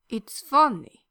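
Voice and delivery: put-on loud, heavy Russian accent; thick Russian accent. A narrator's reading. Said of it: "deliberately laying on his Russian accent even thicker".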